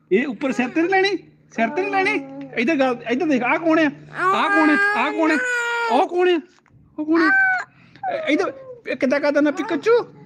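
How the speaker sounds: put-on very high-pitched toddler-directed chatter; playful baby talk. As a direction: coax a little one in a high voice. (ਬੱਚੇ ਨਾਲ ਤੋਤਲੀ ਲਾਡ ਵਾਲੀ ਉੱਚੀ ਆਵਾਜ਼)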